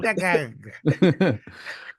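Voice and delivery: high, staccato cartoon sailor cackle; Popeye laugh. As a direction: imitate a cartoon sailor's chuckle. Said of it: "Is that Popeye?".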